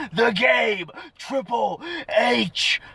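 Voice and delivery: big ring-announcer style impression, rising; hammed-up wrestler impression, building high; Triple H. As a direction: announce a star's return, drawn out and punched. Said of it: "That's my best Triple H impression".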